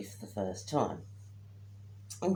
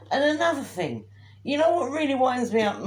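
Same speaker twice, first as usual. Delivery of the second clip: ranting; 9 semitones higher, 5 dB louder than usual. Real, not acted. Catchphrase rant starting up.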